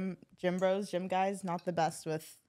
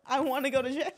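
laughing; 5 semitones higher than usual. Real, not acted. Repeating a friend's joke.

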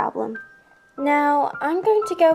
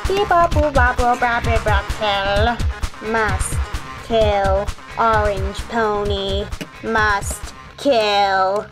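monotone robot voice; flat mechanical killer robot; old rusty robot; 3 semitones lower, slower than usual. Acted. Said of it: "an old robot that helped me".

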